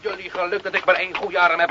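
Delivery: ordinary talk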